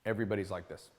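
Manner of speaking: a hint of a deadpan New York commuter, low and soft; blank subway face. Shown as a demonstration. Mocking New York subway riders for his audience, a low, soft deadpan. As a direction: lightly voice a neutral, expressionless subway rider. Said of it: "Here's my impersonation of a New York subway".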